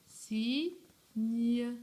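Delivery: "signe" split slowly into two syllables, si, gne. Shown as a demonstration. Teacher showing pupils how the word is written, syllable by syllable. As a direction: sound out "signe" with a clear gap before gne. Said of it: "pour écrire mieux".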